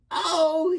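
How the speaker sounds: high, loud cry of alarm; high startled "Oh"; yell. Acted. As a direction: yelp out mid-fall. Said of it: "he yelled".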